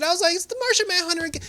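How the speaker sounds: high, rushed fan squeal; giddy retold astonishment; excited surprise. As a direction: blurt it out, pitch way up. Fan reacting to a surprise appearance.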